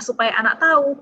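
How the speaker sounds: usual speaking voice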